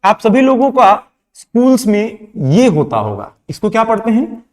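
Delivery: normal talking voice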